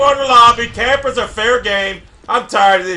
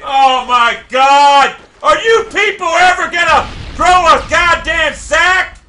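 exasperated shout; 6 semitones higher, 5 dB louder than usual. Real, not acted. Gamer yelling at cowardly players, exasperated shout. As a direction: rant at the whole lobby. Raging at campers.

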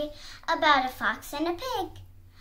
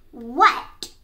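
short, loud growly question; growled; 3 semitones lower, 7 dB louder than usual. A narrator's reading. Fox interrupted by the piglet, growling. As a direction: growl it impatiently. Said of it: "growled the fox".

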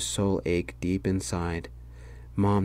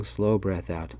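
ordinary delivery

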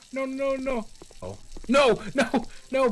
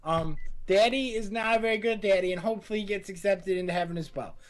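mock prayer; 4 semitones lower than usual. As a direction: pray solemnly over the dead baby with a smirk. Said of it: "Lead us out with the prayer".

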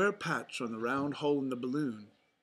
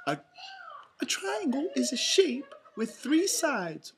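high, loud cartoon mouse; Minnie explaining; 4 dB louder than usual. A narrator's reading. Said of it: "Minnie explained".

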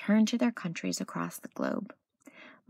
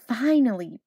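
usual speaking voice

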